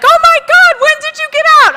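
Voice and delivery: very high, loud excited squeal; gushing with joy at seeing her; thrilled greeting. Retold or imitated. Women meet her after prison.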